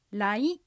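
来 rising upward at the end; rising second tone. Shown as a demonstration. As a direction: lift 来 like a question. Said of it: "二声上に上がります". (来 を上に上がる二声で)

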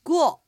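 ぐを with a firm, clear gu at the start; firm initial sound. Shown as a demonstration. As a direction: hit the first sound of ぐを firmly. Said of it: "最初のぐをしっかりと発音する". (ぐを の最初の「ぐ」をしっかりと)

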